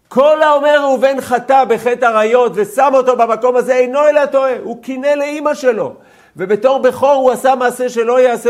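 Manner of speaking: high, loud preaching; fiery preaching. Acted. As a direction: preach it out to the class with full force. Teacher preaching at full force. (דרשה בלהט, גבוה וחזק)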